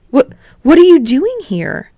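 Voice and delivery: loud, high, caught off guard; startled outburst. Acted. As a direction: blurt it out in shock. Kirishima startled by Bakugo's arrival.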